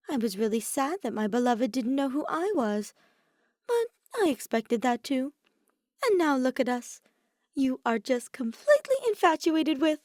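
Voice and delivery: pout, then gush at him; Oma, pouty and playful. Acted. Oma rambles, pouting.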